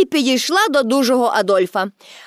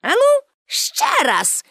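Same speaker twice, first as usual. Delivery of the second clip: much higher, slow and coaxing; encouraging; 13 semitones higher, slower than usual. A narrator's reading. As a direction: cheer the strongman on, slowly. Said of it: "підбадьорливо мовила Пепі". (підбадьорливо, високо й повільно)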